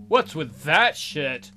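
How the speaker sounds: ordinary delivery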